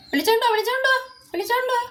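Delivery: high, sing-song call to the cat. Real, not acted. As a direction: call it out high like summoning a pet. Calling out to the cat to come. (പൂച്ചയെ വിളിക്കുന്ന ഉയർന്ന നീട്ടിയ വിളി)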